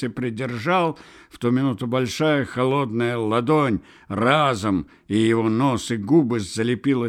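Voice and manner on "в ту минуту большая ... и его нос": ordinary delivery, nothing marked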